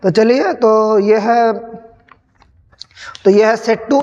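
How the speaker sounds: ordinary talk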